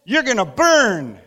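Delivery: high, loud, hammed-up hellfire sermon; mock fire-and-brimstone preaching; brimstone preacher. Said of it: "really turning into a brimstone kind of one".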